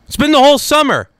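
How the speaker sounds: fed-up outburst about the long summer; exasperated. Real, not acted. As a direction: throw up your hands at it.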